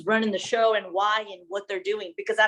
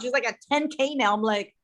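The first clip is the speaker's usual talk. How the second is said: normal talking voice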